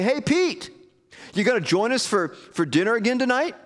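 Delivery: high, eager mock greeting to a pal; friendly calling out. Gentile friend bumps into Peter in the market, cheerful high call. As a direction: call out a casual invitation, pitched well up.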